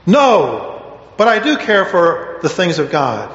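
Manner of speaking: a wounded plea from a confused follower; anguished confusion. Acted. Wangerin goes on to emphasize Peter's thoughts.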